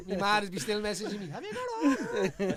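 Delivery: loud, high laughing through the lines; cracking up at the joke. Real, not acted. Joking with hosts about factor fifty, laughing.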